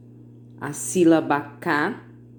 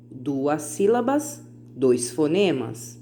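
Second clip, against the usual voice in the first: ordinary talk, nothing marked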